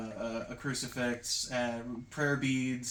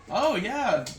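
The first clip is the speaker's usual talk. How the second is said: higher, louder mock-eager reply; light up at the question, pitched up; eager professor; 8 semitones higher, 7 dB louder than usual. Professor answering students about the cult, playfully eager.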